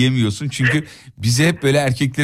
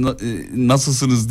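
hesitant, halting; much slower than usual. Retold or imitated.

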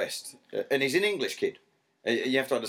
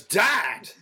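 put-on higher, louder whiny kid protest; a hint of a twelve-year-old whining; son's catchphrase; 10 semitones higher, 5 dB louder, much slower than usual. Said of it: "his catchphrase for twelve".

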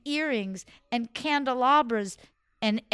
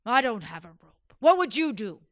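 louder, impatient ruler's demand; demanding sultan; 7 dB louder than usual. Acted. Sultan pressing his servant for an answer, loud. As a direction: bark the question at the servant.

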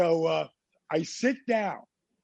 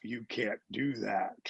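low, soft inner voice; hushed, deep-down better self; voice of conscience; 7 semitones lower, 8 dB quieter than usual. Retold or imitated. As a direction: speak as your own conscience, low and quiet. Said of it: "my good self says".

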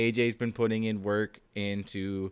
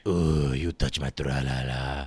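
low silly voice; 11 semitones lower, slower than usual. Pretend. Quoting song in put-on voice.

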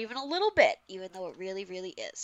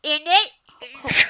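bad British accent; 5 semitones higher, 13 dB louder than usual. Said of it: "in a bad British accent".

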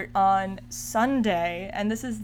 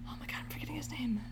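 whispered aside; 3 semitones higher, 13 dB quieter, much faster than usual. Real, not acted. Muttering while searching memory.

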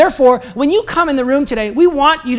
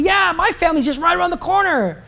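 high-pitched imitation of an excited woman; retold excitement; 7 semitones higher than usual. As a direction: act out her delighted recognition, pitched up. Retold delight at shared hometown.

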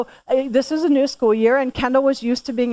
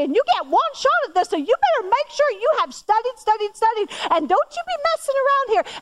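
rising higher and higher, stern and insistent; firm lecturing mom; 6 semitones higher than usual. Retold or imitated. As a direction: lay down the law to her teen daughter. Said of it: "And I'm being firm".

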